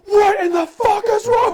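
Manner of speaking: high, loud shouted rage; retold furious yell. Raid group yelling at their returning teammate, furious shout. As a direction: yell it at him all at once. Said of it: "all nine of us are just screaming at him".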